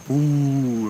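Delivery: long drawn-out mock boo; ghostly boo. Storyteller mocks classic haunting noises, slow spooky boo. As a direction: draw out "boo" like a cartoon ghost.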